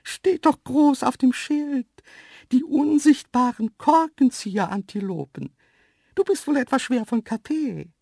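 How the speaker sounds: very high, loud dainty creature voice; cheeky antelope. A narrator's reading. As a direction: pipe it up cheekily, talking down to the human. Antelope cheekily answers narrator. (freche, hohe Antilopenstimme)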